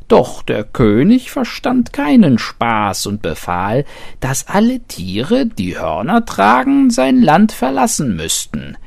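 high, loud, bleating goat voice; a teasing goat bleating out news; cheerful bleating goat. A narrator's reading. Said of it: "meckerte sie munter".

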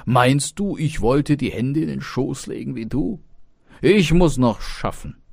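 high, loud indignant retort; heated, scolding protest; indignant father. Acted. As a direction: snap back at the lazy suggestion. Father snaps at idle son.

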